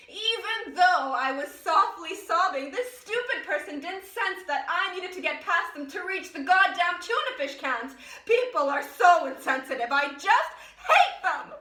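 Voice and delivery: loud, exasperated tirade building to an outburst; angry rant. Acted. Woman venting about the insensitive shopper, loud building fury.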